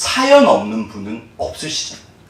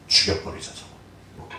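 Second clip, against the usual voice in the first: whispered murder plot, much softer; conspiratorial whisper between brothers; plotting whisper; 10 dB quieter, slower than usual. Retold or imitated. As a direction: hush it like a secret plan to kill.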